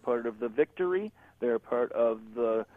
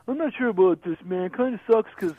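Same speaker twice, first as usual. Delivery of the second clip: high, nasal-free whiny fan voice, much higher; mock complaining kid voice; imitating a whiny kid; 10 semitones higher, 5 dB louder than usual. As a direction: play a whining young fan. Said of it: "the kid will come on and go".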